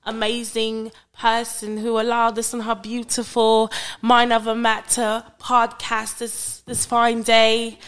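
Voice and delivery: hammed-up British podcast host; British accent. Co-host introducing friends and thanking listeners, British accent. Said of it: "close out in your British accent".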